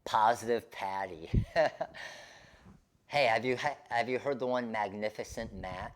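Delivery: ordinary talk, nothing marked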